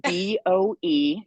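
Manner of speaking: slow letter-by-letter spelling; unhurried, separated letters; spelling out loud. Shown as a demonstration. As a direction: spell it out slowly, one letter at a time. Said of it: "How do you spell it?".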